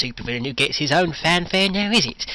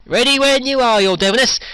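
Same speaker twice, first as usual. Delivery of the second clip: calling out; 10 semitones higher, 7 dB louder than usual. Acted. Calling out to villain.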